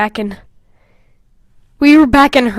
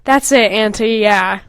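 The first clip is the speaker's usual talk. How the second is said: ordinary delivery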